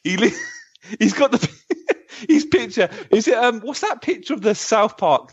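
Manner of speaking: high-pitched, breaking up with real laughter; helpless laughter. Real, not acted. Host reading out a comment to co-hosts, cracking up. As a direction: struggle to get the words out through laughter.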